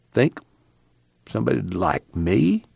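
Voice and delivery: soft, hesitant question; softly. Acted. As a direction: ask it quietly, half hoping. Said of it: "The one-eyed man said softly".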